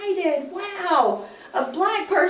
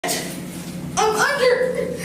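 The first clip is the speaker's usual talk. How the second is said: laughing; 7 semitones higher, much slower than usual. Real, not acted. Drunk suspect giggling while arrested.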